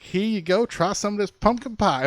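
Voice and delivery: high, bright salesy pitch; hammed-up proud baker offering pie; mock cheerful host. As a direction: sell the awful pie with fake cheer. Walking pie around the bay.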